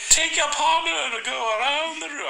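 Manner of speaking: high, hammed-up Scottish accent. Shown as a demonstration. Demonstrating Scottish for co-host, high hammed-up accent. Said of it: "Scottish I can do".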